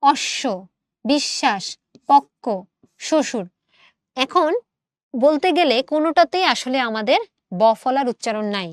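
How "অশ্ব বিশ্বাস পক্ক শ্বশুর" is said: অশ্ব, বিশ্বাস, পক্ক, শ্বশুর word by word, no b sound; careful word-list reading of অশ্ব, বিশ্বাস, পক্ক, শ্বশুর; modelled pronunciation. Shown as a demonstration. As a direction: pause between অশ্ব, বিশ্বাস, পক্ক, শ্বশুর, leaving ব silent. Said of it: "আচ্ছা, উচ্চারণ করো".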